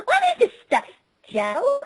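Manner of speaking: put-on high, disgusted girl; high pony voice. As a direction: squeal it, grossed out.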